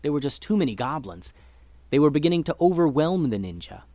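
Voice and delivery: usual speaking voice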